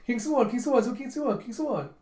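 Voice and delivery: put-on high, loud childlike chanting of King Suwon; little kid calling. Gunte excitedly repeating the king's name. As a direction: call out like an eager little kid. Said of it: "like a little kid going".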